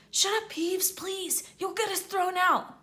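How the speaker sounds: put-on boy begging Peeves not to give them away; Harry pleading.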